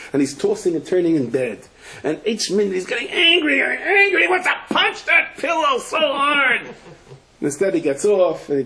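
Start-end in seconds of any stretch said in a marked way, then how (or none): 2.0-6.6 rising, hammed-up fury, much higher in pitch; mock angry. Preacher acting out a sleepless man's anger, hammed-up fury. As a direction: act out his anger building until it nearly laughs. Man tossing in bed, furious.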